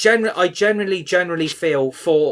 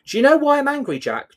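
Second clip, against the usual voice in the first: real anger; 10 semitones higher than usual. Real, not acted. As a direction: fire the question back in real fury. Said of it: "You seem a bit angry".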